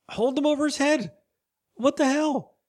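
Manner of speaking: high-pitched, incredulous exclamation; incredulous outburst. Real, not acted. Host marvels at a weak-looking guy's strength, incredulous. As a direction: exclaim it high in sheer disbelief.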